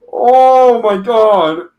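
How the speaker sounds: slow, high, loud oh my God; drawn-out disbelief. Real, not acted. Reader reacting to the exposed username, slow disbelief. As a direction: stretch it out in amused disbelief.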